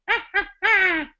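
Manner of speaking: high, loud witch cackle; witchy voice. Shown as a demonstration. Voice teacher demonstrating a witchy cackle for students. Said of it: "little witchy voice".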